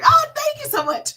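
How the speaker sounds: high, loud squeal of thanks; delighted thanks. Real, not acted. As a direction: burst out with delighted gratitude. Reacting to a compliment.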